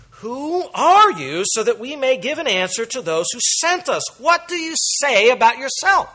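loud, high, insistent questioning of John; demanding delegation. Acted. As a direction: press him hard for an answer.

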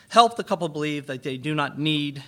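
emphatic "need"; stress on need. Real, not acted. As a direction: hit the word need. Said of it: "emphasizing that word need".

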